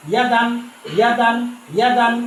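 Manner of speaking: teacher drills ইয়া দান; joining syllables. Shown as a demonstration. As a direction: blend ইয়া and দান into one word. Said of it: "শব্দগুলো দেখেন".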